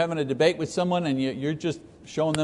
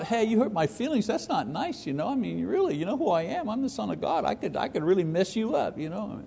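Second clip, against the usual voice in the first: higher, softer, petulant parody; mock hurt whining; 5 semitones higher, 6 dB quieter than usual. Preacher mocking what Jesus didn't say, higher and softer whining. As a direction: play a sulky, wounded Jesus for laughs.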